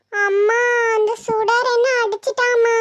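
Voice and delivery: put-on slow, whining little girl; drawn-out weepy child voice; tearful child. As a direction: tell mum tearfully, dragging the words out. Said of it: "ஏம்மா அழுவுற".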